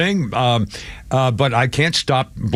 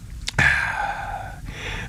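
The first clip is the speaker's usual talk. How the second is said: long breathy sigh; 8 dB quieter than usual. Real, not acted. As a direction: let out a tired sigh. Pause before next story.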